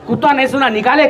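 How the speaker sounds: normal talking voice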